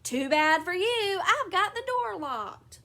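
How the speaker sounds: high, slow taunting call; rude gloating. A narrator's reading. Husband calling out from locked bathroom, rude taunt. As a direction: taunt loudly through a locked door. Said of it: "laughed rudely and called out".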